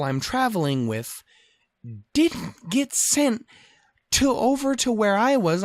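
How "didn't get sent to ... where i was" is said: high, drawn-out indignation; pitched-up, incredulous complaint; exasperated rant. Real, not acted. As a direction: stretch each word in disbelief. Exasperated rant about airport security.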